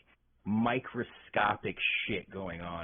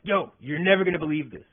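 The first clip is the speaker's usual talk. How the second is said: doctor bursting in, louder and higher; super excited; 4 semitones higher, 7 dB louder than usual. Retold or imitated. Doctor bursts into the patient's room, super excited. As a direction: imitate an excited doctor blurting out big news. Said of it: "super excited".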